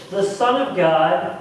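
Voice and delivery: ordinary talk, nothing marked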